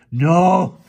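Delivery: very high, loud, slow anguished wail; high loud sobbing lament; sobbing despair. A narrator's reading. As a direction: cry out in horror at the fools. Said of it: "he sobbed".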